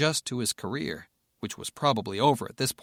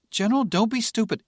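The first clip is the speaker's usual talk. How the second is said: higher, louder rebuke; exasperated snap; 11 semitones higher, 6 dB louder than usual. Acted. Rebecca rebukes the reckless general, higher and louder. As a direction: cut him down sharply.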